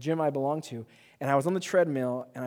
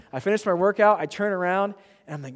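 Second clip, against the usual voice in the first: ordinary delivery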